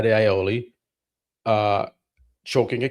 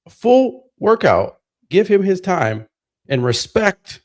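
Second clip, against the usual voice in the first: sarcastic rant; 7 semitones higher than usual. Real, not acted. Sarcastic ranting emphasis.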